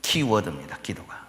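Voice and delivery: ordinary talk, nothing marked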